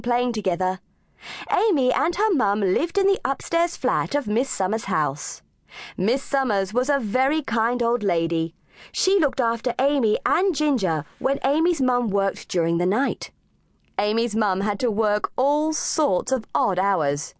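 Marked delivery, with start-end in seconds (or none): none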